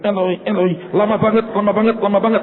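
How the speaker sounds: high, quickening mock plea; hammed-up call from a dangling performer; comic parody cry. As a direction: parody the cry from the cross, impatiently. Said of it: "Dia bunyi".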